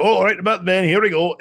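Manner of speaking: loud, high Scottish brogue; Scottish dwarf brogue. Acted. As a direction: throw on a hearty Scottish dwarf accent. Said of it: "throwing the brogue in there".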